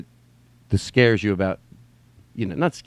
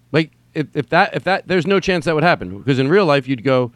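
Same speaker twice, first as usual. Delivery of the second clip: normal talking voice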